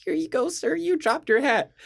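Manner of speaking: put-on polite little old lady offering help; sweet old lady. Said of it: "She's like".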